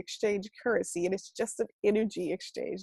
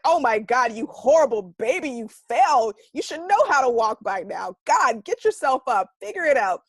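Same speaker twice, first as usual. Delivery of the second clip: louder, exaggerated scold of a toddler; mock scolding; 4 semitones higher, 9 dB louder than usual. A mock harsh parent berating a fallen baby, hammed-up.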